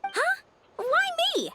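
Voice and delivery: can't believe it's you; startled protest. Acted. Handed the cleanup job.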